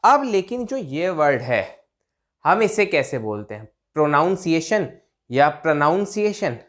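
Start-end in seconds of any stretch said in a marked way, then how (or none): 3.9-6.6 प्रोनाउंसिएशन with 'noun' in the middle; wrong pronunciations. Shown as a demonstration. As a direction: offer प्रोनाउंसिएशन as pro-noun and pruh-noun. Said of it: "wrong guys". (ग़लत, बीच में 'नाउन' के साथ)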